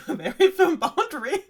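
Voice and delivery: high, loud laughing words. Real, not acted. Laughs while saying it.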